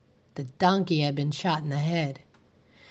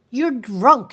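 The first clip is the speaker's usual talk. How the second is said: louder accusing yell; 5 semitones higher, 7 dB louder than usual. A narrator's reading. Teacher yelling at the drunk sheriff. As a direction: shout it at him. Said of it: "she yelled".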